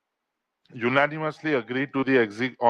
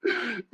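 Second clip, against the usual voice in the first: high, soft giggle; real laugh breaking out; burst of laughter; 10 semitones higher, 7 dB quieter than usual. Real, not acted. Co-host cracks up after the guest storms off, high giggle.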